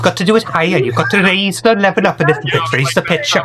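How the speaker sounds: a high-pitched mimic of Fawny. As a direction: raise the pitch and copy her. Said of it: "do it a little higher pitch".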